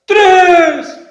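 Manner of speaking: grito agudo y muy fuerte; grito furioso. Acted. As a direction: grita el tres. Monstruo cuenta gritando. (monstruo grita la cuenta)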